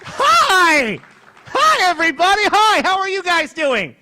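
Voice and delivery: high, loud stage hello; shouted high, easing slightly at the end; loud greeting. Real, not acted. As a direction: holler hello to the whole room. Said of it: "I'm much louder than Jay".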